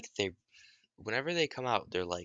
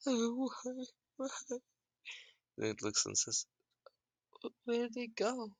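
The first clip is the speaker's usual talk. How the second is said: put-on high, tearful child voice; high crying kid; 4 dB quieter than usual. Sobbing kid asking Naruto where parents went, high. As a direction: whine it like a sobbing boy.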